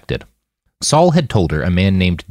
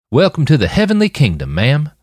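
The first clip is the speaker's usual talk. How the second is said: put-on boy's drawl; 7 semitones higher than usual. A narrator's reading. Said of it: "the boy drawled".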